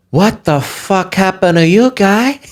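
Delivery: a disbelieving drawn-out question; Sebastian Maniscalco impression. A comic finding a beaten friend, a light incredulous impression. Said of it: "That'd be Sebastian Maniscalco".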